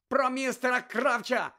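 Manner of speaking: high, loud, slowed emphatic cry; shouting. A narrator's reading. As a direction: shout it to make him understand. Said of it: "закричав Гаррі". (відчайдушний крик)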